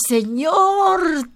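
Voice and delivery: calls Señor out high and loud; a joyful cry up to heaven; retold shout to God. Preacher reliving shouting to God from the van window. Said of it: "Le grité".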